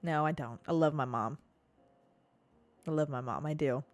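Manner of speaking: ordinary talk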